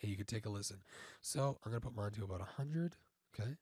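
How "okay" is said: ordinary delivery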